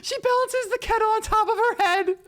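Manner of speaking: loud, very high squeal; real amused astonishment, squealed; hysterical disbelief. Real, not acted. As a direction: shriek it out after a laughing fit. Bursts out after laughing hard.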